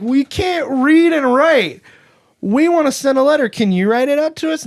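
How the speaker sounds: loud, high mock kid voice; high-pitched kiddie plea, hammed up; whiny pleading kids. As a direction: play needy kids calling out, high and loud. Kids retold pleading to postman.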